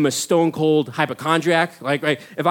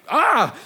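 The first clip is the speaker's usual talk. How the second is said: short high startled scream; mock shriek of shock; roommate's scream; 10 semitones higher than usual. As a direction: yelp at a shocking sight. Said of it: "when he screamed".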